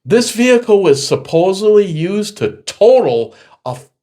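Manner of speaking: telling off the insurance adjuster, loud and high; indignant outburst. Retold or imitated. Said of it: "And I go".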